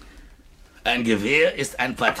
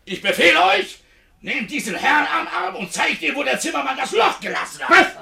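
loud commanding shout; shouting orders; 14 semitones higher, 4 dB louder than usual. Acted. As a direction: bark the order. Ordering servants to throw out.

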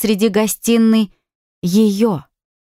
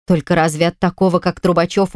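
ordinary delivery, nothing marked

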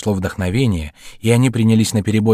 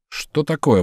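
mildly startled, higher-pitched question; suspicious exclamation; 7 semitones higher, slower than usual. A narrator's reading. Kangetsu questions his two friends, exclaiming suspiciously. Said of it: "воскликнул Кангэцу, подозрительно".

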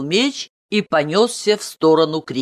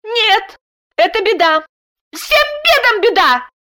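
very high indignant squeal; angry squeal; 3 dB louder than usual. A narrator's reading. Wife contradicts her relieved husband, squealing angrily. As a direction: squeal it out in anger. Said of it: "гневно взвизгнула Даша".

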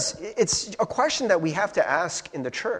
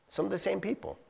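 some of the same people repeated much softer; 3 semitones higher, 11 dB quieter, faster than usual. Shown as a demonstration. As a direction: let it sink in quietly.

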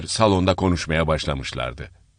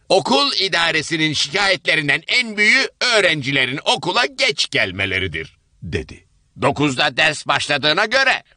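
higher, prim dismissive voice; grey-haired woman correcting a latecomer; stern older woman; 9 semitones higher than usual. Acted. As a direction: brush the excuse aside. Same woman lectures.

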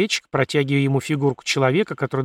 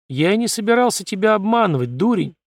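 good-natured snap; 7 semitones higher, 3 dB louder than usual. A narrator's reading. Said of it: "беззлобно огрызнулся Ли".

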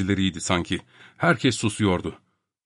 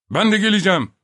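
blurting in; 10 semitones higher, 4 dB louder, faster than usual. A narrator's reading. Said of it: "diye atıldı Topak".

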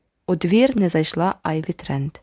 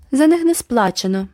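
loud high jab; declaring; 6 semitones higher, 4 dB louder than usual. A narrator's reading. Boy taunting the neighbour girl, loud. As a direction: announce it loudly to sting. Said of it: "заявив Джеральд".

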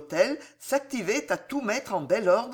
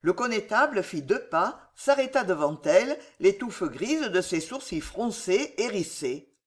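ordinary delivery

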